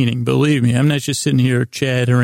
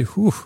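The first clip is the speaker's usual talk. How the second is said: a quick whew of relief, pitched up; mock relieved whew; 7 semitones higher than usual. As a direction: blow out a little whew. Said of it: "they say".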